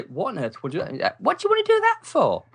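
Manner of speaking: soaring high bewildered question; baffled why anyone reads; incredulous mum. Retold or imitated. Mum wondering why the boy reads books, rising high disbelief.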